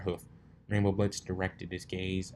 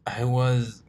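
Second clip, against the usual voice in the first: strained colt; 4 semitones higher, 7 dB louder than usual. Acted.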